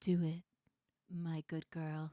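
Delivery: lightly voiced low, firm husband; a hint of a commanding husband; husband's low command. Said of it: "he was straight up like".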